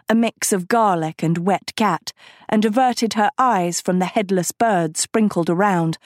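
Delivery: ordinary delivery, nothing marked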